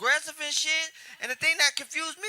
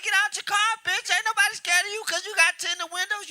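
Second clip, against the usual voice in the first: higher-pitched mock bravado; talking trash; 7 semitones higher than usual. Comic taunts a man in his car, acted trash talk. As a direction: talk big to provoke a rival. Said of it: "I was talking shit, too".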